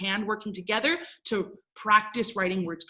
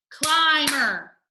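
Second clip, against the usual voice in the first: "climber" loud, high, as climb-er; syllable by syllable; 5 semitones higher, 5 dB louder than usual. Shown as a demonstration. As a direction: beat out both syllables of "climber". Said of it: "break it up by the vowel sound".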